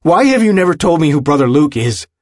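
high, loud accusing question; angry demand. A narrator's reading. Willem interrupts his friend to confront him, angry.

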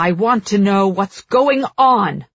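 loud, furious royal demand; railing king. A narrator's reading. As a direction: demand it at full voice. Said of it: "The king rails".

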